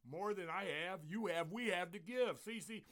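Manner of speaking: loud, high, urgent pulpit delivery; rousing preaching. Acted. Pastor calls out to his listeners, preaching forcefully. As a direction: rouse the congregation, demanding a response.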